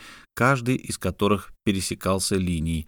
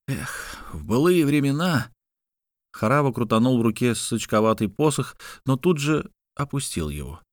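ordinary delivery, nothing marked

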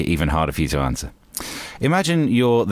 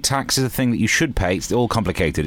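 ordinary delivery

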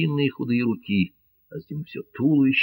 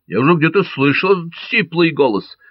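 higher lively Carmaux voice; Carmaux voiced; 9 semitones higher, 4 dB louder than usual. A narrator's reading. Кармо вспоминает голос, живо и выше. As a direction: Carmaux puzzling over a familiar voice, higher.

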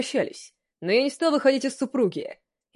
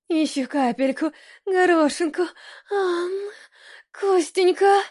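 put-on high breathy girl voice; 8 semitones higher, slower than usual. Female character near climax.

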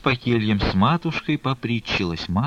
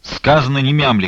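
louder, higher snapped command; a sharp shout to cut her off; 5 semitones higher, 7 dB louder than usual. A narrator's reading. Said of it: "крикнула игуменья". (громкий окрик)

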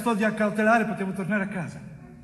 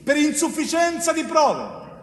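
higher, louder, slow outraged cry; 10 semitones higher, 4 dB louder, slower than usual. Acted. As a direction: fling the words out in disbelief. Verdict read out in outrage.

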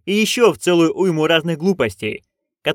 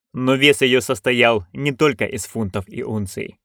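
ordinary delivery, nothing marked

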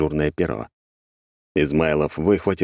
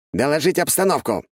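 barked order; 9 semitones higher than usual. Acted. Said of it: "— гаркнул он".

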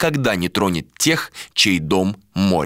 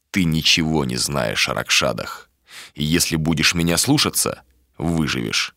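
low menacing man; 5 semitones lower than usual. Acted. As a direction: lower the voice, threaten calmly.

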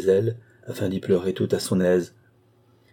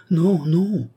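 loud, higher protest; cry out; 9 semitones higher, 4 dB louder than usual. A narrator's reading. The boy grabs Flavie's hands, crying out no, loud and high. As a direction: cry out to stop her. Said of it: "m'écriai-je".

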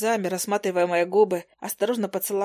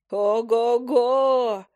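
very drawn-out mock-bass holler; bass bellow; 3 semitones higher, 4 dB louder, much slower than usual. A narrator's reading. Fairy barging in on the kissing couple, bellowing. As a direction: holler it long, stretching each syllable. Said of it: "заорал он головой Факиным басом".

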